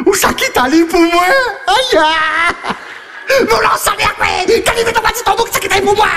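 heated shouting. Acted.

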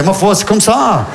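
a drawn-out, resigned "Ma foi, c'est comme ça"; slow resigned shrug. Retold or imitated. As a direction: shrug it off slowly, like nothing matters. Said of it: "tu fais". (fataliste, lent, détaché)

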